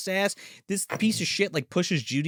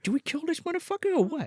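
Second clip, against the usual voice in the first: conspiring puppet voice; 10 semitones higher, 5 dB quieter, faster than usual. Retold or imitated.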